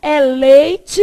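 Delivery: leite loud, with a clear "ei"; emphatic "LEI-tchi", full diphthong; i pronounced. Retold or imitated. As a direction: hit LEITE hard, sounding the i. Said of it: "o I não some e é pronunciado".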